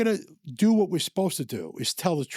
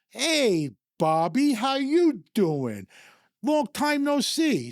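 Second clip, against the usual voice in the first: slow, higher, sly hello; mock friendly greeting; 4 semitones higher, much slower than usual. John greets the racetrack debtor with a mock-friendly hello. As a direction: greet the man who owes you like an old pal. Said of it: "John goes".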